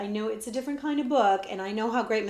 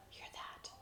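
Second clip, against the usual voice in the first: unvoiced hushed question; breathless whisper in the woods; 24 dB quieter than usual. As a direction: whisper it, listening for danger. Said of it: "whispered the prince".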